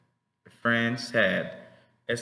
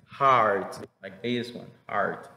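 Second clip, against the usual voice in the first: usual speaking voice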